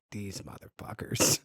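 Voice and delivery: low, whispered curse under the breath; muttered cursing. Retold or imitated. Friend grumbling about the reconciled couple, muttered whisper.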